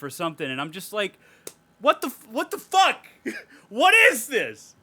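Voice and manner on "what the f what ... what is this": high, loud, disbelieving yell; exasperated shout at a baffling movie; incredulous shout. Retold or imitated. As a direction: blurt it out in outraged disbelief. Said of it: "I know I'm, like, getting excited".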